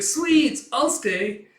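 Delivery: put-on a kid's squeal of delight at sweets; excited kid. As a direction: jump at the sweets like a greedy little kid. Anya grabbing at sweets offer.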